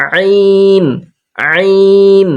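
আইন said with the middle of the throat lightly squeezed; throat-squeezed ain. Shown as a demonstration. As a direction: press the throat gently on আইন. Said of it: "হালকের মাঝখানটা হালকা চিপে আমরা পড়ব". (গলার মাঝখান চেপে আইন)

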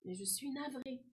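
soft, sighed apology; sorry sigh. A narrator's reading. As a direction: sigh it out quietly, sorry to disappoint. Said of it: "soupira l'arbre". (soupir navré, tout doux)

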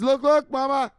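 high, loud whiny son's voice; high-pitched son pestering his mother; Michelle. Acted. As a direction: plead with mama to look. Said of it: "this is what Michelle says".

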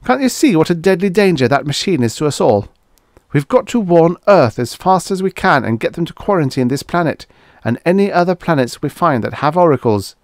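high-pitched desperate warning. A narrator's reading. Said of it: "turned desperately to Bates".

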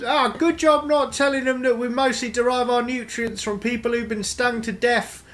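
high, chirpy moss voice; high, smug talking moss. Acted. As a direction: share a dark secret cheerfully. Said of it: "the moss pipes up and goes".

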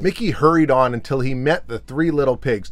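plain reading voice

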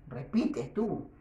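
ordinary delivery

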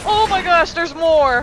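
loud, high panicked cry. Real, not acted. More enemies appear.

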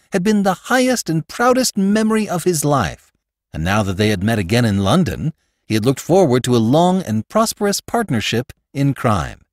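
ordinary delivery

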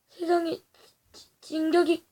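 usual speaking voice

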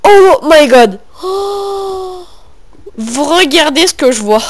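loud high astonished gasp; amazed. Real, not acted. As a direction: gasp at what he sees. Amazed by find.

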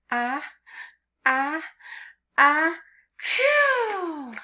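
hammed-up sneeze; big mock sneeze. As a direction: build up ah, ah, then a high loud achoo. Ant sneezes in the pepper.

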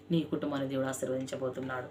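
quiet blessing. Acted. Closing blessing prayer.

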